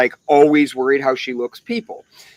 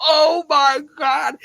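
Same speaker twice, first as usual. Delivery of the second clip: slow, high mock dismay; drawn-out, high mock shock; a put-on gasp of disbelief; 11 semitones higher, slower than usual. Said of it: "I'm like".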